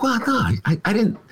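high, innocent Trump impression. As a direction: play innocent, just asking questions. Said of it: "he can sort of divorce himself from, like".

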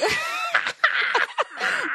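high shrieking burst of laughter. Real, not acted. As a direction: scream with laughter.

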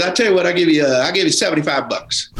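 imitated Southern mechanic; Southern accent. Mechanic making a lowball offer, Southern accent. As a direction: do a Southern good-old-boy offer. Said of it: "a Southern accent".

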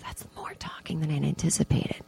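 unvoiced whispered aside. Comic whispering an aside to the audience about talking. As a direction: drop to a breathy whisper.

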